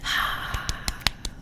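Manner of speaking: tired sigh. Real, not acted. Sigh before changing topic.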